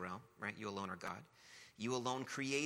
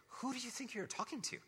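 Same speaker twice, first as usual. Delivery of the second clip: much higher-pitched, incredulous comeback; God's indignant challenge. Acted. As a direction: demand it in a high, mock-affronted voice. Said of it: "When God starts to talk like this".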